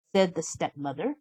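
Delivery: ordinary delivery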